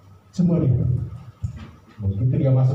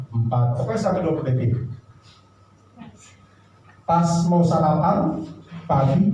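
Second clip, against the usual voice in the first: ordinary talk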